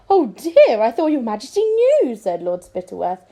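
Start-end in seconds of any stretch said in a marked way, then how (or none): 0.1-2.1 high, loud mock startle; feigned surprise. Scheming lord faking shock for the king, high and loud. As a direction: fake being startled. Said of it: "pretending to be startled".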